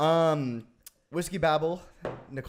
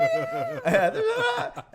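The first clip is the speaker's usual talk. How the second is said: high, crying laugh; wheezing laugh; 3 dB louder than usual. Real, not acted. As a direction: laugh until it squeaks. Laughing hard after the scream.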